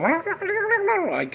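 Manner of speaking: mock Cousin It gibberish. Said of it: "talking like that".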